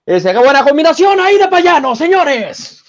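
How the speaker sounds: Spanish-language boxing commentator voice; Mexican boxing commentator. Retold or imitated. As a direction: call it like a Mexican ring announcer. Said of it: "como narrador mexicano de boxeo".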